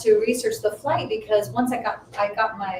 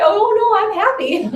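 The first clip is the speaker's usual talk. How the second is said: high, loud mock wail; happy crying; 13 semitones higher, 9 dB louder than usual. Crying friend assuring client, mock happy wail. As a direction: imitate blubbering happily at full pitch. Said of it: "I just started crying".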